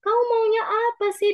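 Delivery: high, pressing mock yell; imitated tearful yelling. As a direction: act out her exasperated demand, pitched high. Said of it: "Dia sampai teriak-teriak kepada saya".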